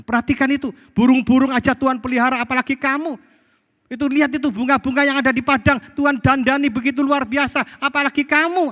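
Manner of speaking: high, loud, urgent sermon delivery; full-force preaching. Real, not acted.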